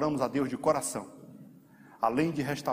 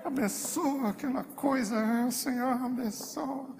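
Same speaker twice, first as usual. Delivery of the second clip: grudging blessing; 9 semitones higher, slower than usual. Retold or imitated. Believer praying for someone he resents, grudging and drawn-out. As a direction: bless him through clenched reluctance, stretching each word. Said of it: "abençoa ele".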